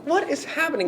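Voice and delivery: very high bewildered squeak. Retold or imitated. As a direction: squeak it, totally baffled. Bewildered inner reaction.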